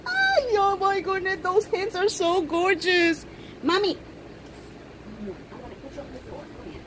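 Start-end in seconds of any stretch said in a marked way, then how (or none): 5.2-6.8 hushed rushed aside. Real, not acted.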